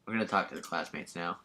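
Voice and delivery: ordinary talk, nothing marked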